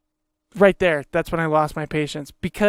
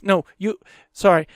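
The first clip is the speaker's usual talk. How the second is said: ordinary talk, nothing marked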